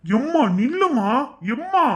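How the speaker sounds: loud, slow plea rising to a high cry of Mum; calling out. Acted. Son calling after his mother as she leaves, loud and pleading. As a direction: call her back before she walks away.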